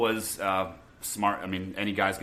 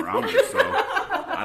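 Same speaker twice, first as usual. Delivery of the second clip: high, slow, laughing words; 8 dB louder than usual. Real, not acted. Laughing at own story.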